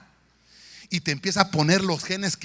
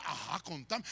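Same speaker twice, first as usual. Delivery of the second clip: lower, softer eager gossip; gossip's hush; 6 semitones lower, 15 dB quieter than usual. Retold or imitated. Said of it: "solo medio escuchan a uno". (chismoso en voz baja)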